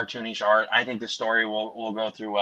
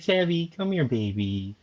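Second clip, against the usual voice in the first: sing-song coaxing for a pet; baby voice; 2 semitones higher, 5 dB quieter than usual. Shown as a demonstration. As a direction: call the cat over in baby talk. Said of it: "I talk to her in a baby voice".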